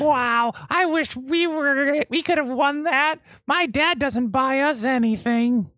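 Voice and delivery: put-on very high, eager little boy; gee-whiz child voice; wholesome 1950s kid.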